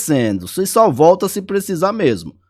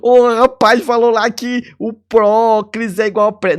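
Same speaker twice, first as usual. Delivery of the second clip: lightly voiced student snitching, pitched higher; mock student; 7 semitones higher than usual. Student repeating the tip to another teacher, lightly mocking voice. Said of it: "não vai falar pro professor lá".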